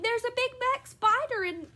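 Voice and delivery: high-pitched frantic yell; alarmed yelling. A narrator's reading. Mother yelling to daughters about a spider, frantic yell. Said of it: "pounding on the bed and yelling".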